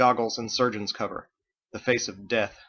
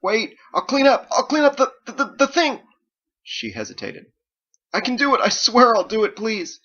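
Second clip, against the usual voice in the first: much higher and louder, stammering; desperate pleading; 12 semitones higher, 8 dB louder than usual. Acted. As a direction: beg for your life.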